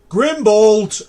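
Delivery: loud call of a name. Acted. Professor calling the stone head by name, loud call. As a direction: call the door by name, loudly. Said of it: "he'll turn and go".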